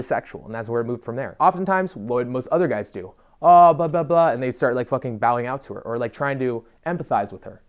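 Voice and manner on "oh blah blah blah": mocking blah blah. Mocking what other guys say.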